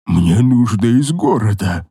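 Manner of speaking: low slow grunting voice; a monster in a manly croak; croaking bass whisper. Acted. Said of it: "прошептала она отвратительным полумужским кряхтящим басом".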